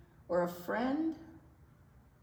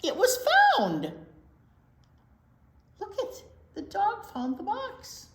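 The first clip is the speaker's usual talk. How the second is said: much higher and louder, joyful call; delighted storytime exclamation; excited; 11 semitones higher, 9 dB louder than usual. Acted. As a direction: cheer the kids on at the happy turn. Lost box is found.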